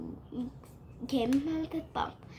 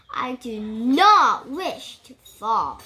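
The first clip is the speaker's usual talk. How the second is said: loud fish plea; 10 dB louder, slower than usual. Acted. Fish voiced pleading in story.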